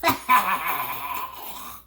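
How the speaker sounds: high loud cackling laugh; horrible bird laugh. A narrator's reading. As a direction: let out a nasty bird cackle. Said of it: "with the same horrible laugh".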